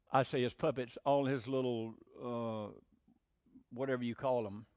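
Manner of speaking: normal talking voice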